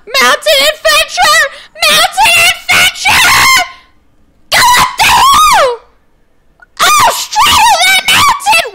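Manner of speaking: very high, full-volume shrieking; frantic screaming. Real, not acted. Player racing up a mountain in the game, frantic high screaming. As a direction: shriek each phrase, stretched out.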